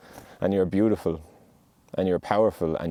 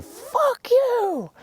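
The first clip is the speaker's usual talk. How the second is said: very high, strained scream of defiance; reenacted scream; 4 dB louder than usual. Reenacting anger at the sky, restrained high scream. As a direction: scream it held back, far above your usual pitch. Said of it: "I'm not going to scream".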